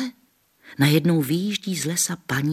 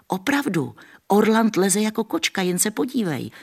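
put-on high, excited boyish call; eager calling; 9 semitones higher, 3 dB louder than usual. A narrator's reading. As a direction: convince grandma to look. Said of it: "volal Jan". (Jan nadšeně volá na babičku)

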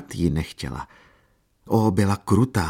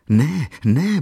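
higher, alarmed "no, no"; startled protest; 8 semitones higher, 4 dB louder than usual. A narrator's reading. Katerina fending off Jan Maria's reproach, startled. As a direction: protest in sudden fright. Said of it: "bránila se polekaně".

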